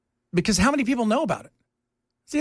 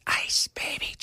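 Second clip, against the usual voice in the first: whisper; 6 dB quieter, slower than usual. Acted. Teasing story title.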